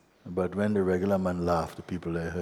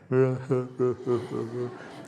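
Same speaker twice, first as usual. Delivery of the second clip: amused chuckle; 5 semitones higher than usual. Real, not acted. Teacher laughs about ego crying.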